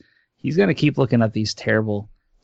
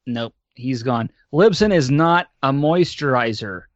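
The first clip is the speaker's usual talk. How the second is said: ordinary talk, nothing marked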